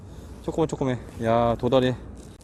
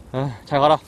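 normal talking voice

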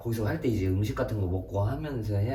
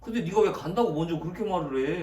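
arguing; 7 semitones higher than usual. Real, not acted. Friends quarrel over the plan.

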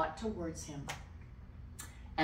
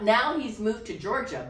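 ordinary talk, nothing marked

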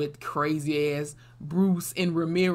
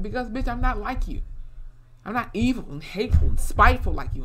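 raised, emphatic mock telling-off; imitated defiant retort; 5 semitones higher, 4 dB louder than usual. Brooke answering Montana on why she helps, defiant. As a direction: throw each insult back, higher-pitched.

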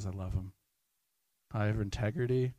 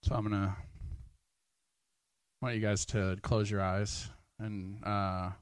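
hushed altar-call guidance; guided prayer; 3 dB quieter than usual. Acted. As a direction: guide them into quiet prayer. Leads prayer time.

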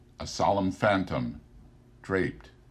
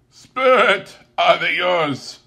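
horrified Scrooge; 11 semitones higher, 11 dB louder, slower than usual. Acted.

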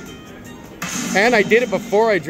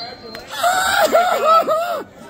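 very high ecstatic laugh-shout; shrieking laugh. Real, not acted. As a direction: burst out laughing with joy. Celebrating grand jackpot.

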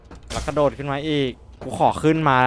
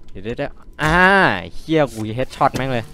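ordinary talk, nothing marked

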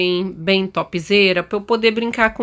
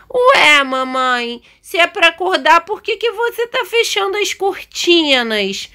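put-on high, whiny child voice asking mom; puzzled kid's high voice; puzzled little daughter; 8 semitones higher than usual. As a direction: play a small child protesting, pitched up high. Voices the daughter questioning her mother.

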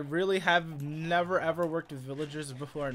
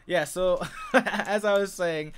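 laughing; 3 semitones higher, 7 dB louder, slower than usual. Real, not acted. Laughing off the chaos.

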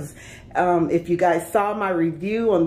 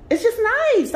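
excited gush; faster than usual. Real, not acted. Showing viewers her kitchen, gushing excitedly. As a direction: gush over it, voice jumping up. Gushing over apartment details.

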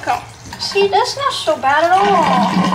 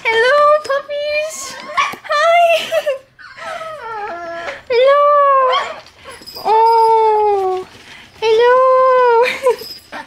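puppy greeting; 10 semitones higher, much slower than usual. Real, not acted. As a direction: coo hello up high like meeting puppies. Greeting the puppies.

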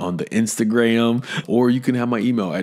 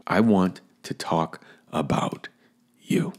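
intimate whisper; slower than usual. Acted. Host turns to thank listeners.

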